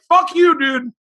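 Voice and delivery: mock retort. Acted retort to friend.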